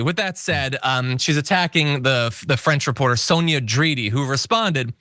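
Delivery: normal talking voice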